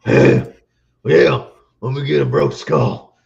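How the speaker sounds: lightly voiced gruff wrestler ordering beer; a hint of Stone Cold Steve Austin. Buddies ordering beer at the bar, mock wrestler voice. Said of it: "doing our Stone Cold voices".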